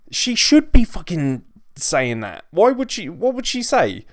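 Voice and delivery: exasperated rant. Real, not acted. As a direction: burst out in disbelief. Ranting at wrestler's promo.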